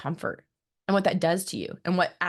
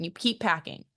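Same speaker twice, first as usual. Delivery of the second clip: usual speaking voice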